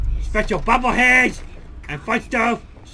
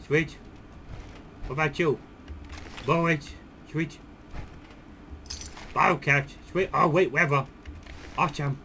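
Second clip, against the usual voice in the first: softer, lower muttering to himself; quiet looting mumble; 9 semitones lower, 15 dB quieter than usual. Real, not acted. Player loots bodies after the fight, quiet mumbling. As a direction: mumble through the loot.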